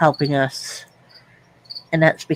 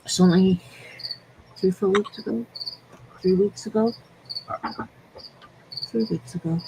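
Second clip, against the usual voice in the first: tearful; 5 semitones higher, 6 dB quieter, faster than usual. Real, not acted. Grieving friend's recent death.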